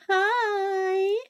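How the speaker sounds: high bright villager greeting; chirpy villager. Acted. The villager rushes over to greet the hero, chirpy.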